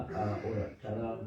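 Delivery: ordinary delivery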